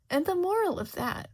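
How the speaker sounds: loud, high proclamation; sudden loud public voice. A narrator's reading. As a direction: announce it to the whole court. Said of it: "she says much louder".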